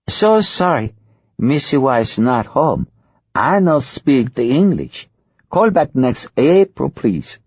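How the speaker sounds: high, slow, hammed-up fake Spanish accent in broken English; atrocious fake Spanish accent. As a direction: pretend to be a maid with a terrible Spanish accent. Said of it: "If that's supposed to be a Spanish accent".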